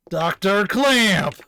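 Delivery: loud, slow call with CLAMP stretched out; drawn-out shout. Acted. Officer trying to rouse the unanswering doctor, drawn-out shout. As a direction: holler it, dragging out the name. Calling again, louder and longer.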